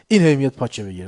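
ordinary talk, nothing marked

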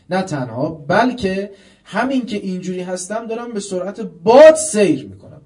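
climbing to a loud, high preacher's cry; fiery preaching. Acted. Preacher urging listeners toward true repentance, rising to a loud cry. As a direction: build the sermon until you're shouting it.

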